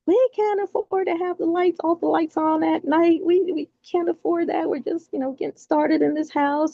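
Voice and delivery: high, put-on voice for the husband; mock husband. Imitating husband nagging about bills, high voice. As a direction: mimic the husband scolding about the lights. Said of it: "He's like".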